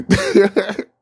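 a loud disbelieving laugh; high loud laugh. Real, not acted. Retelling the governor shutdown, loud laugh.

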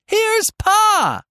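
put-on very high child-like call. A narrator's reading. Roxy spots Pa arriving, high calling out. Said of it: "Roxy called out".

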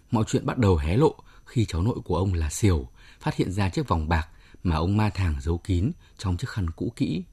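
usual speaking voice

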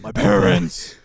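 loud, unpitched superhero rasp; hammed-up tortured vigilante; Batman. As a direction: force out a loud mock rasp. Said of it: "you wanna wake up to Batman".

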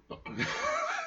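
squeaky breathless giggle; high squealing laugh. Real, not acted. Bursts out laughing.

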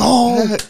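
high, loud "oh" of excitement; retold whoop from a thrilled fan; excited yell. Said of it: "I was so excited".

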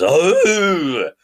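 loud high stag call; stag bellow. Retold or imitated. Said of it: "that's my deer impression".

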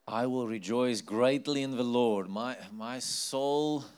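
loud, ringing scripture read; rejoicing proclamation. Acted. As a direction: proclaim it with joy. Exultant reading of scripture.

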